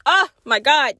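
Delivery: ordinary delivery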